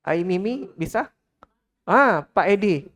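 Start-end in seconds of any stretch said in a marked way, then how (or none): none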